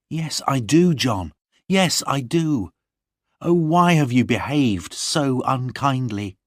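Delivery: put-on a young lady wounded by her lover; young Lorna. Narrator voices Lorna.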